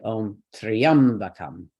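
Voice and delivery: "Triambakam" slow, leaning hard on "Triam"; stress on TRIAM. Shown as a demonstration. Teacher showing students where stress lands, "Triam" stressed in "Triambakam". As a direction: draw out "Triam", then let "bakam" fall away lightly. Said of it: "so we'll stress triam".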